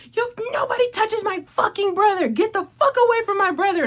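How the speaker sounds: protective fury. Retold or imitated. Imitating the narrator's character defending his brother, furious. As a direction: threaten anyone who touches your brother. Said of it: "you can just hear it in his voice".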